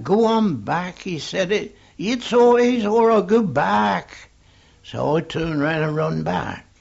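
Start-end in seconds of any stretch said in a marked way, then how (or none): 0.0-1.0 high, loud warning call; raised, urgent order; retold shout. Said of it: "He shouted back". 1.5-4.3 high, loud holler; full-voiced, high-pitched call; retold shout. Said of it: "He shouted back".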